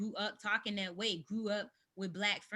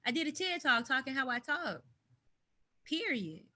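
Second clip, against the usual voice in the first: ordinary talk, nothing marked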